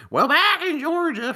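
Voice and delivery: very high, loud mocking Southern twang; exaggerated Southern accent, taunting mimicry. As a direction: parrot it back like jeering classmates. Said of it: "mocking Southern accent".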